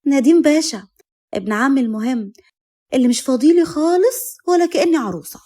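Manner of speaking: high, lively teasing complaint; cousin's hammed-up sulky reproach; playful mock hurt. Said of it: "لؤى عملت نفسها زعلانة".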